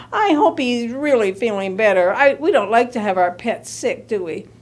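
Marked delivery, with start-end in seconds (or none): none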